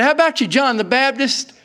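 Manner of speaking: high, loud radio call-out. Retold or imitated. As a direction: hail Dad over the CB like a kid. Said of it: "I said".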